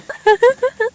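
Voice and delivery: a high, silly giggle. Acted. Daughter-in-law laughs at wrong time. (बेवकूफ़ सी ऊँची खिलखिलाहट)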